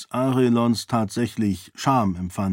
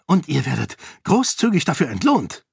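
a touch of a hissed snap, voice higher; hissing retort; 6 semitones higher than usual. A narrator's reading. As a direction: Edan snaps back at Eondel, bitter. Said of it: "fauchte Edan". (leicht gefauchte, höhere Erwiderung)

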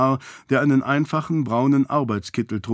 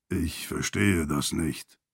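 very low, slow and soft; confused; 7 semitones lower, 7 dB quieter, slower than usual. A narrator's reading. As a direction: puzzle over it quietly in a deep voice. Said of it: "sagte Archie verwirrt". (verwirrt, leise und tief)

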